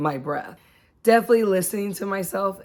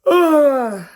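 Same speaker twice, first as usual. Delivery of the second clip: loud, high pushed-out grunt; strained grunt; 10 semitones higher, 10 dB louder than usual. Shown as a demonstration. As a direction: force the extra air out. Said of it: "get this extra".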